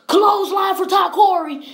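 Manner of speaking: high, loud shout of the move; opgewonden uitroep. Real, not acted. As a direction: shout it like a ringside commentator. Calling a wrestling move as it lands.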